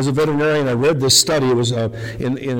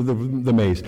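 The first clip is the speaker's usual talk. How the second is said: normal talking voice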